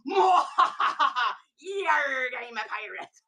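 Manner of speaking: mock evil laugh into a pirate growl; gleeful pirate. Buyer gloating in the car, hammed-up pirate cackle. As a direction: cackle like a pirate who got away with loot. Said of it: "you go".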